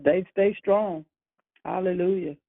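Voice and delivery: usual speaking voice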